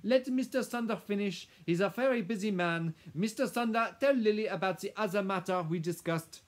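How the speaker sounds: put-on high, loud, foreign-accented commands; imperious Madame. Madame ordering the lawyer to continue, imperious. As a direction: take control of the room forcefully.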